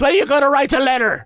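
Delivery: high, fast, stern priest voice. Retold or imitated. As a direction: play a scolding priest, quick and high.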